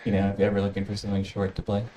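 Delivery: normal talking voice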